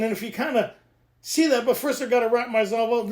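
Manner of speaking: normal talking voice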